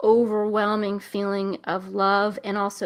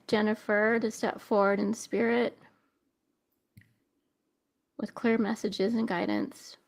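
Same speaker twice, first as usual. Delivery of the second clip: spirit invocation; 4 dB quieter than usual. Acted. Medium invokes the spirit.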